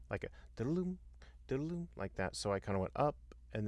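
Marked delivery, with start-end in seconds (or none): 0.6-1.9 lilting musical swoop, pitched high; imitated up-and-down figure. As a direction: sing the little arc of the tune. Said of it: "that's what it sounded to me like".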